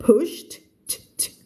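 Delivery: PUSHED ending in a crisp T. Shown as a demonstration. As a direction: release a separate T after PUSHED. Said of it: "ลงท้ายด้วย E D เป็น T".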